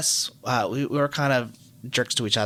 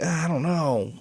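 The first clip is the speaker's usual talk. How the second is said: drawn-out, higher-pitched reluctance; hesitant; 4 semitones higher than usual. Retold or imitated. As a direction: hem and haw, imitating someone unsure. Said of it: "of course, hesitant".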